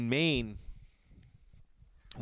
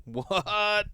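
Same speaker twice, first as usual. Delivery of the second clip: mock surprise; 9 semitones higher, 4 dB louder than usual. Mock reaction to audience.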